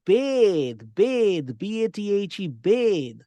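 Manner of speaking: "bathe" with a long a like bay, stressed and loud; verb bathe. Shown as a demonstration. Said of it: "the pronunciation changes to".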